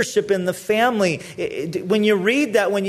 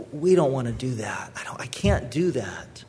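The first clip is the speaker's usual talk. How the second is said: hushed, softer reluctant protest; whispered refusal; 4 semitones lower, 5 dB quieter than usual. Retold or imitated. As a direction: let it shrink to a whisper of giving up. Reluctant believers refusing God.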